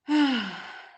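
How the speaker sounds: loud exhaled sigh; heavy sigh. Real, not acted. Reacting to Haiti's troubles, a heavy sigh. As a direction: let out a long, heavy breath.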